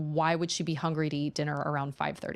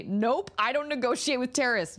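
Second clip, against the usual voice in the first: higher-pitched, put-on refusal; mock-firm mom; 7 semitones higher than usual. Mom refusing toddler's lollipop demand, mock-firm voice. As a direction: play a parent firmly shutting down demands.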